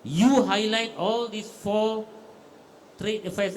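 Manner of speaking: slow emphatic instruction. Real, not acted. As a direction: lay out the instruction word by word.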